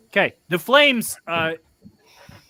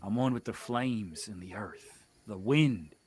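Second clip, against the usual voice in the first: menacing witch voice; 8 semitones lower, 9 dB quieter than usual. Acted.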